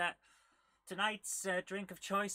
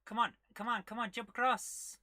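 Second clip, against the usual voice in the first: urging on; 6 semitones higher, 6 dB louder than usual. Real, not acted. Urging the game character on.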